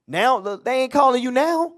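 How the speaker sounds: preacher warning the church, high and loud. Real, not acted. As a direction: lift the voice to hammer the point home. Preacher stresses warning to congregation.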